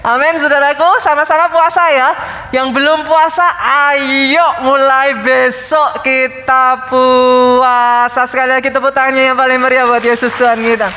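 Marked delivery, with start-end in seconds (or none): 3.9-8.2 very slow, stretched syllables; long, sustained exhortation to the congregation; drawn-out rallying call. Real, not acted. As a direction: stretch each word out to rally the crowd. Rallying congregation to fast tomorrow.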